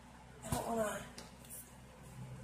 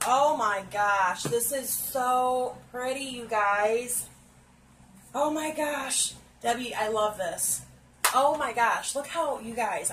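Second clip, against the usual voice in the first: louder, slow and drawn out with awe; thrilled gushing; 5 dB louder than usual. Real, not acted. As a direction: gush over the colors at full volume. Thrilled by the palette.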